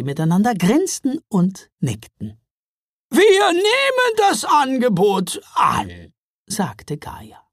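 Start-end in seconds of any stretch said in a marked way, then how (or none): 3.1-6.1 high, slow troll woman; a proud troll mother accepting. A narrator's reading.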